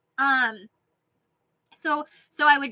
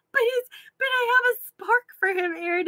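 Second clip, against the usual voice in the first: whiny plea; 9 semitones higher than usual. Retold or imitated. Pleading with husband again.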